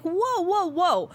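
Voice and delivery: high, startled mock alarm; alarmed whoa. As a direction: pull back in mock fright. Alarmed quote to creepy date.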